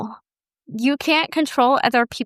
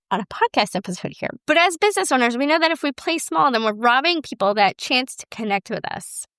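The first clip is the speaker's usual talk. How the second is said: ordinary talk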